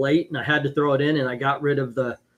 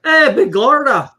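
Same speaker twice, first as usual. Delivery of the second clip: loud, high-pitched mock Mexican accent; 5 semitones higher, 7 dB louder than usual. Chat host showing his best Mexican, exaggerated accent. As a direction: call out in a hammed-up Mexican accent. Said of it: "My, my best Mexican would".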